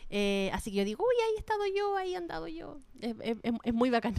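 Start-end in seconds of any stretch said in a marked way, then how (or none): 1.0-2.8 delighted recognition. Retold or imitated. Acts out own delighted recognition.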